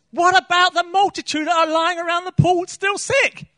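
pleading shout. Pretend. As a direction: call it out as if pleading for the sick.

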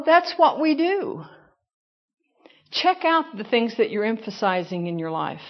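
normal talking voice

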